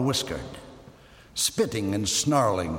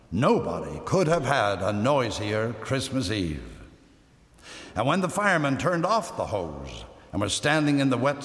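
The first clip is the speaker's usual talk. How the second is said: ordinary delivery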